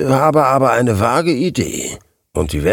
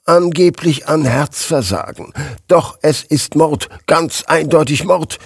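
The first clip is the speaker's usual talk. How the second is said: higher, emphatic declaration of murder; agitated insistence; 6 semitones higher, 3 dB louder than usual. A narrator's reading. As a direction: insist on it, hammering each word. Distraught editor insists it was murder. (aufgewühlt und nachdrücklich, mit erhobener Stimme)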